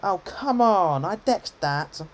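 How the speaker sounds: loud, high, drawn-out "come on"; real gamer indignation; exasperated protest. Real, not acted. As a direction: protest an unfair hit, stretching the words. Protesting a hit in the game.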